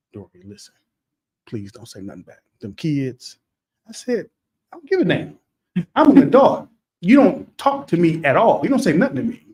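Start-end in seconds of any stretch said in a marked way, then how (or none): 0.1-3.4 pleading softly. Retold or imitated. Wife grabs arm, pleads. 4.7-9.5 defiant retort. Retold or imitated. Answering wife, defiant.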